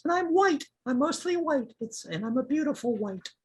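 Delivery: very high, preening little voice; proud tiny flower voice. Acted. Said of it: "another one that goes".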